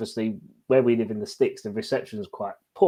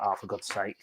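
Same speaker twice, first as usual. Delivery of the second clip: soft, deflated groan; exasperated; 5 semitones lower, 9 dB quieter than usual. Retold or imitated. Fan to radio news of VAR, quiet and exasperated. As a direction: let the air out in frustration. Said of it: "And I was like".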